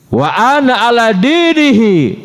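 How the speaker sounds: a hint of Hamzah's heated outburst, drawn out slowly; blurted oath. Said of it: "keceplosan ngomong begitu".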